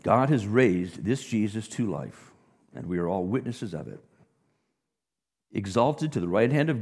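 loud, ringing scripture reading; preacher booming out the apostle's words; preaching scripture. Acted. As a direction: proclaim it to the crowd. Continues scripture proclamation.

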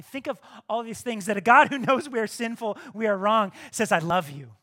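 loud, building sermon voice; preaching at full force. Acted. Preacher to congregation on God's love, loud and fervent.

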